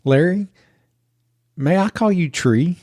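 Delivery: put-on high, slow little-girl voice; Mira. Little girl from the back seat to the driver, high and slow. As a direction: imitate a child's earnest, drawn-out request. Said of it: "I hear Mira say".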